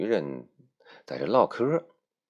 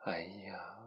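whisper; 3 semitones higher, 14 dB quieter than usual. Acted. Xiaolan's exclamation.

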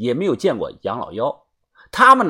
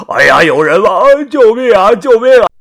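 much higher, much louder cries for help; shouting for help; 9 semitones higher, 11 dB louder than usual. Acted. Tied-up captive screaming for rescue in the wilderness, loud and high. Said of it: "他不停地大喊道".

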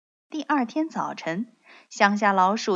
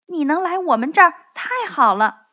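high, loud, happy welcome; excited and louder; delighted; 8 semitones higher, 9 dB louder, faster than usual. A narrator's reading. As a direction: burst out with joy at a friend's visit. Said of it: "高兴地说".

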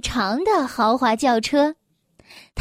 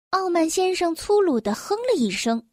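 ordinary delivery, nothing marked

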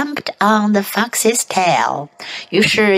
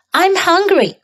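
high voice; 8 semitones higher than usual. Shown as a demonstration. Practice take.

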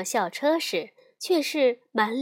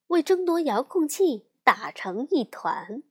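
plain reading voice

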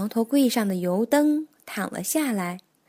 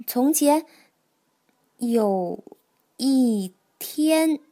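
reading aloud haltingly; much slower than usual. Acted. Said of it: "跳跳刚开始学认字".

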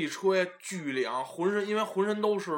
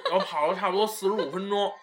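normal talking voice

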